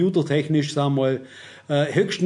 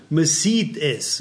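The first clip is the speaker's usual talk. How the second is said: slow emphatic; much slower than usual. Shown as a demonstration. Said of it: "das heißt ganz einfach".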